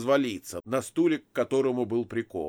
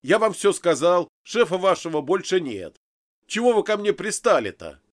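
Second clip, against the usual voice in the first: loud, high-pitched pleading protest; raised voice, fed up with questioning; exasperated shouting; 7 semitones higher, 6 dB louder than usual. A narrator's reading. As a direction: keep yelling in exasperation. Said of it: "сорвался я на крик".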